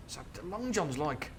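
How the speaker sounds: plain reading voice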